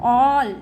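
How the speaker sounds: all with a rounded ऑ, said loud. Shown as a demonstration. Teacher modelling A for learners, loud all with rounded ऑ. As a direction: punch out all with a long ऑ. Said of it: "ऑ के रूप में भी".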